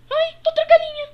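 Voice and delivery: high, fast frightened yelp; panicked. Acted. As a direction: shriek in alarm. Panic at approaching chickens. (gritando em pânico)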